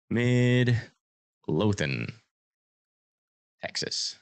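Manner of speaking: Midlothian drawn out very slowly; testing how to say Midlothian; pronunciation test. Shown as a demonstration. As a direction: sound out Midlothian slowly, then whisper Texas. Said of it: "is it Midlothian or Midlothian".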